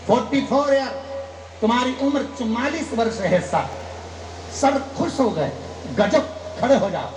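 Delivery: ordinary talk, nothing marked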